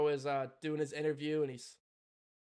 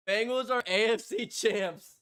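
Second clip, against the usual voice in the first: ordinary delivery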